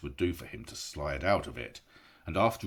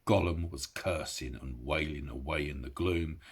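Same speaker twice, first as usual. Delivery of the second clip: usual speaking voice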